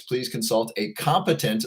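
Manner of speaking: ordinary talk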